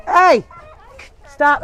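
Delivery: loud, high call to stop; sharp scolding. Real, not acted. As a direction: cut in to halt a misbehaving animal. Breaks off to scold animal.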